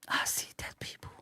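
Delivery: put-on Sixth Sense kid whisper; spooky whisper. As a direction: whisper it like the haunted boy. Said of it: "movie quote whisper".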